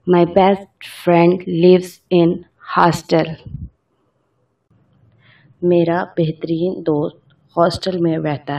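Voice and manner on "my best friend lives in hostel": very slow, word by word dictation; slow example sentence. Shown as a demonstration. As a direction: read it out slowly for learners. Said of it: "example sentence".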